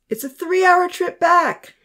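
high, loud and slow call. Acted. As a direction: call over to her daughter. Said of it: "her mom called out from a distance".

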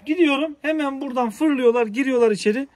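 high, loud excited running commentary; thrilled as they dash inside. Real, not acted. Excited while animals dash inside. (heyecanla yüksek sesle anlatma)

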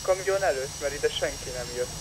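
normal talking voice

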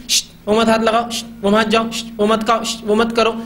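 each short ban led by a sharp shush; mock parental shushing. Parents stopping a child at everything, mock shushing. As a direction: imitate a parent hushing and forbidding. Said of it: "क्या नहीं करो वो तो हम सब बोलते हैं".

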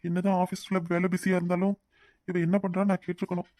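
hushed musing. Acted. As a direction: keep it down beside someone sleeping. Husband talks over sleeping wife. (தூங்குபவர் அருகே மெதுவான தனிப்பேச்சு)

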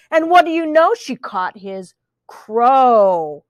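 ordinary delivery, nothing marked

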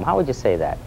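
normal talking voice

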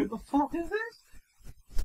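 slow, high disbelieving cry; mock shock. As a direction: act stunned and baffled. Acted shocked reaction.

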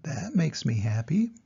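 plain reading voice

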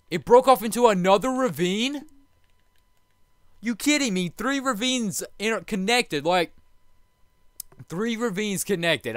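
can't believe what he sees; stunned. Real, not acted. Finds three connected ravines.

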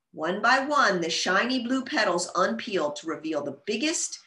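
plain reading voice

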